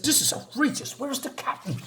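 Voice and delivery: high-pitched mock outrage, then it drops away; a bystander demanding the captain in outrage; indignant outburst. High indignant demand for the captain. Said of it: "somebody says".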